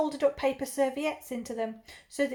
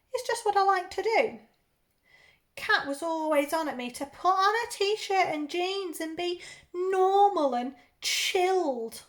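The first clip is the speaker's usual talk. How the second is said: ordinary delivery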